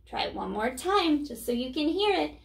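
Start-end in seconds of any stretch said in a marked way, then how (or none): none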